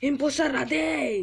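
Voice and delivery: loud, high-pitched cry to the game; high, punchy plea at full voice; shouted demand. Pretend. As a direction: call it out loudly, begging for the role. Begs game for impostor role.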